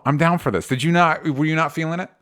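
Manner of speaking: ordinary talk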